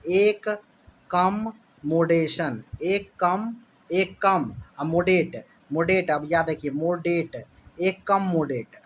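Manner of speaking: "एक कम मोडेट" said as separate pieces, slowly; spell-it-out memory chunks "एक कम मोडेट"; word split into chunks. Shown as a demonstration. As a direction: break it into "एक", "कम", "मोडेट" with gaps. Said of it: "एकोमोडेशन को कैसे रखिए".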